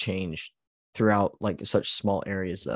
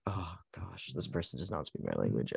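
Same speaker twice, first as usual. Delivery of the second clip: low and much softer, a mock worried aside; hushed mock foreigner; 4 semitones lower, 10 dB quieter than usual. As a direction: mutter it as the puzzled local, deep and soft. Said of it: "'cause they were like".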